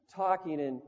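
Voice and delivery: normal talking voice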